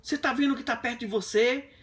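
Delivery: high mock warning to the wife. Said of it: "eu falei com ela assim". (avisando a esposa, voz mais aguda)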